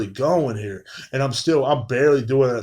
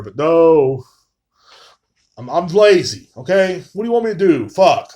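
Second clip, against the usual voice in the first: louder, higher, fed-up defensiveness; exasperated outburst; 4 semitones higher, 7 dB louder than usual. Real, not acted. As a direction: throw it back at the chat. Defends his laziness to chat.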